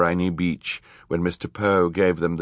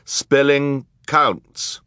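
high, loud, very slow ruling; slow, emphatic judge's reprimand; stern; 8 semitones higher, 5 dB louder, much slower than usual. A narrator's reading. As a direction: lay down the rule sternly, stretching each word. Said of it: "the judge said sternly".

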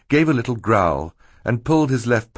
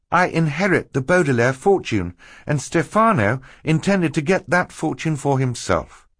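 put-on higher, earnest girl character voice; higher-pitched explaining voice for Violet; 6 semitones higher than usual. A narrator's reading. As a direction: lay out the villain's plot, pitched up. Narrator voices Violet explaining the plot.

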